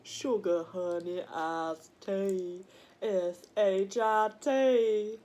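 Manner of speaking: slow, stretched-out acronym; drawn-out spelling. Acted. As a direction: stretch each word and letter out slowly.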